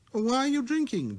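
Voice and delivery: put-on a curious boy calling out; little prince. Prince voiced.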